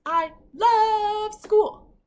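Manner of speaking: high, loud, slow, stretched-out shout; belting the capitalized words for the class; shouted line. Shown as a demonstration. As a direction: shout it out like it's all capitals. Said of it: "You can even sing it or shout it".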